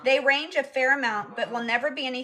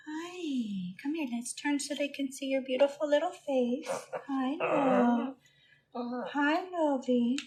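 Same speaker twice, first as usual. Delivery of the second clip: soft, affectionate pet talk; cooing to a pet; 3 semitones higher, 5 dB quieter than usual. Real, not acted. Owner turning her bird round for viewers, cooing. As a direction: coo tenderly to the bird.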